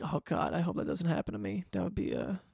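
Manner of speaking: a quiet anxious thought under the breath; worried inner voice. Retold or imitated. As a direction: murmur your fear to yourself. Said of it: "So I was like".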